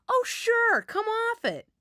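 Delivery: high, loud sarcastic scoff; scoffing friend. A narrator's reading. Friend scoffing at the migraine excuse, high and sarcastic. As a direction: brush off the lame excuse, high and mocking.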